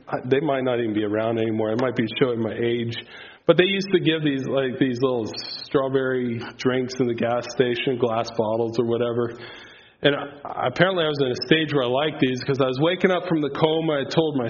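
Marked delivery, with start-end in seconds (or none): none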